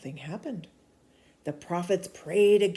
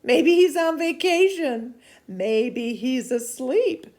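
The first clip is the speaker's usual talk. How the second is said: teasing; 7 semitones higher, 9 dB louder than usual. Acted. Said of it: "Elijah began to tease them".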